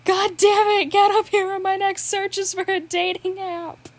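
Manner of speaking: Will yells from upstairs for Hannibal to come up; impatient call. Acted. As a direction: shout down the stairs, fed up but teasing.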